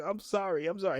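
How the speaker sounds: lightly acted nervous apology; Garibaldi backing away. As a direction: apologize quickly while backing off. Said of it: "he's trying to back up".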